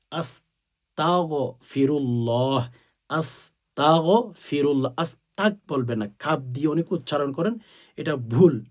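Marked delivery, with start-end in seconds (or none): 0.1-4.9 slow, careful আস্তাগফিরুল্লাহ with a clear গ sound; drawn-out আস্তাগফিরুল্লাহ, every syllable exact; correct Arabic pronunciation. Shown as a demonstration. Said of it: "আরবিতে পারলে আরবিতে পড়বেন". (ধীরে, শুদ্ধ উচ্চারণে আস্তাগফিরুল্লাহ)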